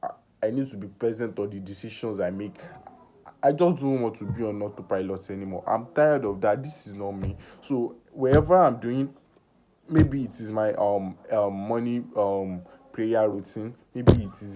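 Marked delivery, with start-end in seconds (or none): none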